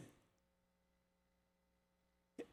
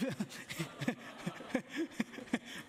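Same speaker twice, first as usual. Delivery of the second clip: amused laugh; 5 semitones higher, 3 dB quieter than usual. Real, not acted. Pastor laughs at the 05 birth year.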